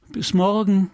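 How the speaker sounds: calling goodbye into the dark; calling after him. Acted. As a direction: call out after him as he leaves. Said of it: "rief sie".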